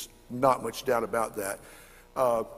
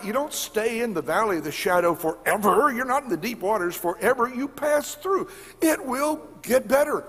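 higher and louder, rising with conviction; excited realization; 10 semitones higher, 6 dB louder than usual. Retold or imitated. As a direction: preach it out as the penny drops. Preacher's excited inner realization.

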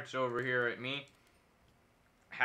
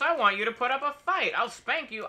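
1 mock scolding; 9 semitones higher than usual. Scolding teammates playfully.

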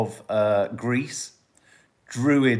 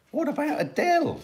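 high exclaimed question. Shown as a demonstration.

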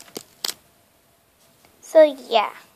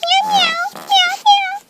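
much higher, louder little cat meows; kitten meows; 5 dB louder, slower than usual. Pretend. Kitten begging its owner, high meowing.

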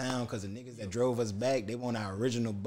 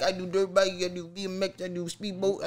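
light imitation of a Mexican accent, higher; lightly mocking Mexican accent, a bit higher; 6 semitones higher than usual. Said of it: "they saying all this with an accent".

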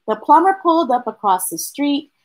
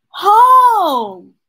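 high and louder happy exclamation; excited child; 9 semitones higher, 6 dB louder than usual. Acted. Charlie telling his parents the best part, excited. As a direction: shout the answer with joy.

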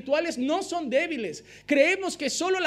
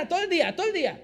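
laughing; 3 semitones higher, much faster than usual. Real, not acted. Laughing about drugged-like joy.

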